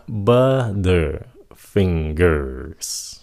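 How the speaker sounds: "Butterfingers" very slow, broken into syllables; careful model. Shown as a demonstration. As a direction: spell out "Butterfingers" sound by sound, slowly. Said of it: "Các bạn nghe kỹ đi nha".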